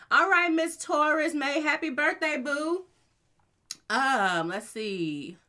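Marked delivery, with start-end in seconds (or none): 0.1-2.8 excited birthday wish. Real, not acted.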